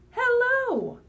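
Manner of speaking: high, loud chirpy hello; animal voice. Acted. The foxes and blue jays call back a high, loud hello. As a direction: greet back like a playful fox.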